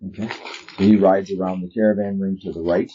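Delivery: ordinary talk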